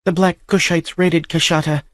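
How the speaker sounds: high, hurried telling. A narrator's reading. Captive girl hurrying her tale to the stranger. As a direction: rush through the story. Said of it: "she continued hurriedly".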